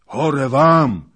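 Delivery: high, very loud shout; explosive furious yell; angry retort. A narrator's reading. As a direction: fling the curse back at them. Hetman retorts to gloomy warning.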